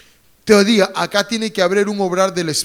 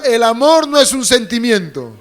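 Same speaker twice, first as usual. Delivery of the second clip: raised-voice call to repeat; out loud; 3 dB louder than usual. Shown as a demonstration. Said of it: "Voz alta, diga".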